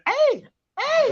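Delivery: high mock Canadian hey. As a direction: toss off a cartoon Canadian eh. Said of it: "like they say in Canada".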